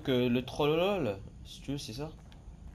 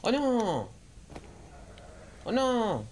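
panicking gamer; 9 semitones higher, much slower than usual. Real, not acted. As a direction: wail as you get caught. Chased, dying in game.

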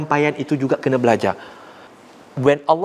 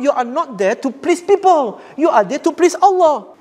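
forceful preaching; 10 semitones higher than usual. Real, not acted. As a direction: drive it home like a firm preacher. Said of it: "Berani cakap".

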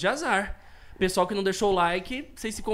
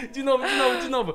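laughing; 6 semitones higher, 6 dB louder than usual. Real, not acted. Laughing, begging for more.

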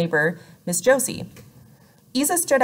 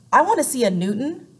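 put-on high, eager child's exclamation; lightly excited little girl, pitched up; exclaiming; 5 semitones higher than usual. A narrator's reading. Lainey asking her siblings about Newton, exclaiming. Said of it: "exclaimed Lainey".

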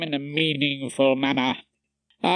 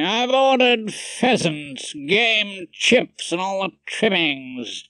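higher, drawn-out list; relishing the menu; 6 semitones higher than usual. A narrator's reading. Host telling Rumpole about the dinner, relishing. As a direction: announce each dish with relish.

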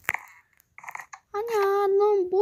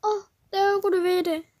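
usual speaking voice

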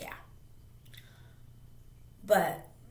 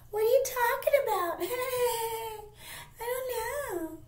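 very high, squeaky mock innocence; whiny, exaggerated girly imitation; mean girl's voice. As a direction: mimic her playing dumb in falsetto. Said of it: "that's how she talked".

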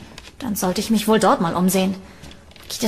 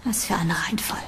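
softer whispered letdown; a breathy, soft aside of disappointment; 3 semitones lower, 6 dB quieter than usual. Acted. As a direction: whisper it to yourself, deflated. Disappointed, muttering to herself.

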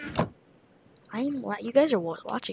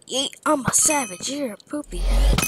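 put-on higher, louder childish taunting; playful taunt; 5 semitones higher, 5 dB louder than usual. Gamer taunting an opponent, childish. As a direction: tease like a bratty kid.

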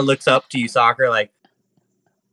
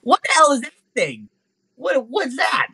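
very high, incredulous monkey voice; astonished, pitched way up; startled little monkey; 13 semitones higher than usual. Acted. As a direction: squeak your shock at a strange animal.

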